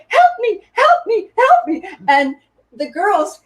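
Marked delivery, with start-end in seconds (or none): 0.1-1.9 high, loud pleading help me; much higher, louder mock panic; desperate cry for help. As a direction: call out helplessly, stuck on your back like a turtle. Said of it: "And I'm going".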